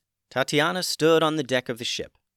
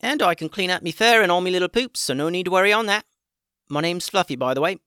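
high, fast, loud critter voice; chatty little critter voice; guinea pig voice; 7 semitones higher, 5 dB louder, faster than usual. A narrator's reading. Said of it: "the guinea pig continued".

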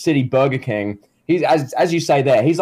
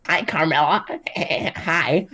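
high, soft, giggly mock greeting; giggling simp; 4 semitones higher, 4 dB quieter, slower than usual. Simps walking in to greet Carmela, giggly mock-shy hello. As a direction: imitate a smitten simp tittering hello, pitched up. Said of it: "All these, like, simps will walk in".

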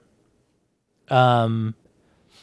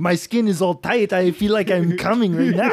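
put-on laughing Austrian accent, pitched up; Arnie impression; 5 semitones higher than usual. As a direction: do the Austrian bodybuilder voice through laughter. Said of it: "That was perfect".

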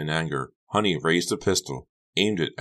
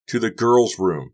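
raised, drawn-out shout; shouted reply; 7 semitones higher, 7 dB louder, slower than usual. A narrator's reading. Becca to Carrie while hurrying away, shouted reply. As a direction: shout it back over your shoulder. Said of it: "Becca shouted".

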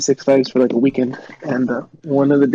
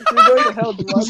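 laughing disbelief; mock scolding through laughter. Real, not acted. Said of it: "You're horrible".

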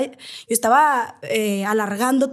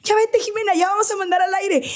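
hurried producer; 7 semitones higher than usual. Retold or imitated. Producción le apura al aire.

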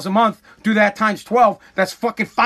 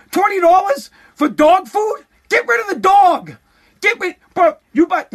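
outraged rant; 9 semitones higher than usual. Real, not acted. Ranting at pet owners about twenty-dollar dog food, outraged shouting. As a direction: rail at the price, voice climbing.

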